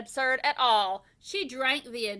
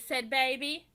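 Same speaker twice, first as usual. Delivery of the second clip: ordinary delivery, nothing marked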